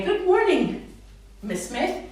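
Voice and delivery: high, loud, sing-song hello to the teacher; bright modelled greeting. Shown as a demonstration. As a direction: greet big and clear so the child copies. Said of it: "So you say it like they would".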